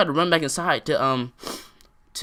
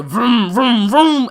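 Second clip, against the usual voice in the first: loud, raised vroom noises; imitated engine revving; 7 semitones higher, 7 dB louder, much slower than usual. Telling how she revved at the fight, loud engine noises. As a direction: mimic a revving engine with gusto.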